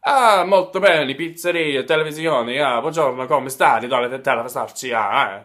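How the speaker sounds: loud, hammed-up Italian accent; over-the-top fake Italian gush; Italian Donatella parody. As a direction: rattle off mock Italian like an excitable diva. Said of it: "really highlight the Italian in her".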